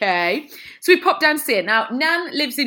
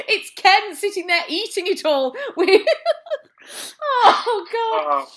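laughing fit; 10 semitones higher than usual. Real, not acted. Cohost loses it laughing at the Ken joke. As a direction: crack up while you talk.

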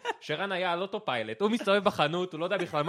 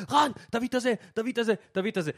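higher, louder, repeated bring-it demand; mock yelling; 7 semitones higher, 4 dB louder than usual. Friends yelling orders at Ran in the store. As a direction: bark orders over each other. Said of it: "כולם במקביל צועקים עליו".

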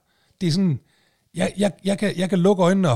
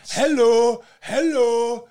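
high, louder drawn-out "hallo"; mimicked calling out; 6 semitones higher, 4 dB louder, much slower than usual. Complainer mocking the stranger who kept calling, imitated holler. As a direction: parody a nagging call for attention. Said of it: "Du begynder da ikke at stå".

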